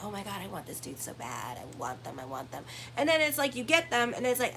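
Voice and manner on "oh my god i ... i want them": soft low breathy longing, mocking; mock pining. Astrologer mocking Libras craving a dude, soft low pining. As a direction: pine for him in a hushed voice. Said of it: "worshiping a dude and being like".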